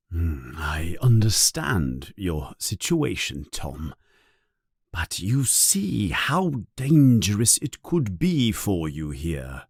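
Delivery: put-on whispered, frail old wizard; hushed old headmaster. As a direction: breathe it out like a weary old man. Old headmaster speaks gently.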